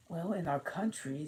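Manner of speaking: put-on high, lightly puzzled girl voice; a curious little girl wondering aloud; Alice voice. A narrator's reading. Alice's line.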